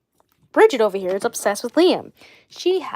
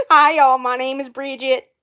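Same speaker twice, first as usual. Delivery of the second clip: put-on Alabama farm-girl accent; 2 semitones higher, 4 dB louder than usual. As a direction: imitate a twangy farm-girl introduction. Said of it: "she's just like".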